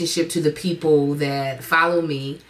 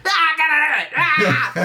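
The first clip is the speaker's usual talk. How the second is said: high-pitched manic shout; hammed-up frantic yelling; Tasmanian devil frenzy; 11 semitones higher, 3 dB louder than usual. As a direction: burst out like a sudden idea just struck. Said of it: "This Tasmanian devil".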